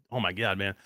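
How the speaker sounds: normal talking voice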